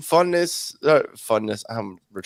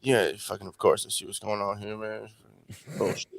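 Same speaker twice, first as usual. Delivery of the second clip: mock grumbling under his breath, much softer and lower; imitating a mutter; 8 semitones lower, 11 dB quieter than usual. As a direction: mutter it like a sore loser in court. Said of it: "mutter shit under his breath".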